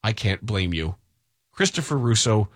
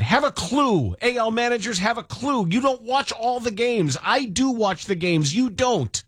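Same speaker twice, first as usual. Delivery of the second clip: imitating rant; 8 semitones higher than usual. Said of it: "he said".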